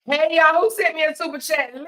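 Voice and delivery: high, loud, thrilled; excited. Real, not acted. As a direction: squeal with delight. Thrilled at donation.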